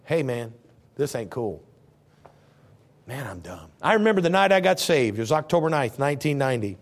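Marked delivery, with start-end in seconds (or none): 0.0-3.7 low, soft, dawning sheepishness; sheepish admission. Acted. Jonah to himself, low sheepish admission. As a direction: mumble it like you just caught on. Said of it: "Till you finally go".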